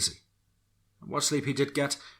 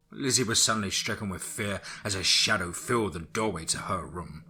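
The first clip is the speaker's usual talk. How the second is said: plain reading voice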